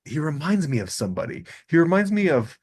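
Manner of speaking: ordinary delivery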